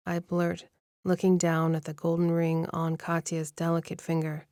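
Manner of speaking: ordinary delivery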